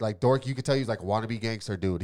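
normal talking voice

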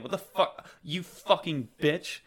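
mock-furious NPC; angry. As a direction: spit out the insult as an affronted villain. Game master acts furious villain.

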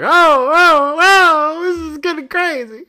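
very high, loud startled yelps; hammed-up startled falsetto; mock alarm. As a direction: squeal it in comic shock. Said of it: "And I'm like".

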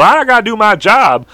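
high, put-on hero voice; Teeny Weeny Strangler. Superhero about to act, high mock resolve. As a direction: declare duty in a high comic twang. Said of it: "I made this character".

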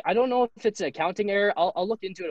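imitating a French sales rep, voice lifted high; Hugo's French accent. French rep calling a player about unpaid gear, mock French accent. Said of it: "he's French".

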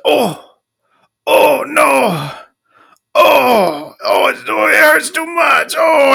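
high, loud hammed-up crying out; mock pained cries. Imitating his hookup crying out in pain, high and loud. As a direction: ham up his lover's pained wails, pitched high. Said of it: "and he was like".

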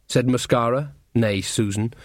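usual speaking voice

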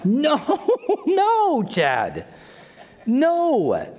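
high, amused refusal with a laugh; lightly voiced Lord shaking his head; God's gentle no. The Lord answering Chad, amused and high. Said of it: "And the Lord is like".

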